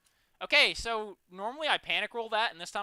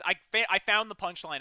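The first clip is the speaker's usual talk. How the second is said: normal talking voice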